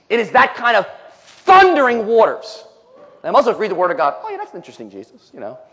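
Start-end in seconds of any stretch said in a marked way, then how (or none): 1.4-2.6 loud, high and drawn out; thundering emphasis. Shown as a demonstration. Illustrates Jesus' thundering voice. 4.2-5.6 soft, offhand murmur, dropping away; mock indifference. Mimics indifferent Bible readers.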